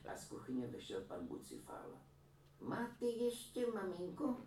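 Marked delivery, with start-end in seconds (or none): 2.6-4.4 put-on higher, slow voice of a proud old mother; a granny boasting about her son's painting; proud old woman. A narrator's reading. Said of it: "optala se stařenka". (vyšší pomalý hlas pyšné stařenky)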